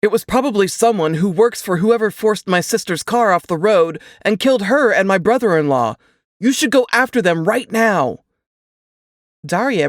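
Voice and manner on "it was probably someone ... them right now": higher and louder, pressing hard; urgent grieving brother. Acted. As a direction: push her to chase the killers now. Grieving brother urges cop.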